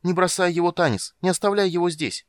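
high, loud, urgent begging; high-voiced anxious plea; pleading. A narrator's reading. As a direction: implore him not to abandon a friend. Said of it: "взмолился тот".

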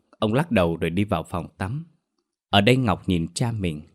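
ordinary delivery, nothing marked

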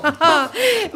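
put-on a loud, amused old woman's cackle; hearty cackle. Old lady laughing at herself to Edward, hearty cackle. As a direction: laugh out loud at her own joke.

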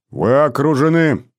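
loud metallic megaphone voice; booming metallic robot. A narrator's reading. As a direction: bark it through a loudspeaker. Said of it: "металлический голос".

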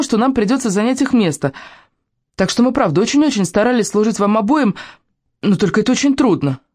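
put-on high, earnest girlish voice; anxious pleading girl. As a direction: plead your case like a nervous child explaining herself.

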